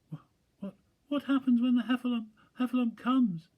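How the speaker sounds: a tiny animal scared of the heffalump; trembling. Acted.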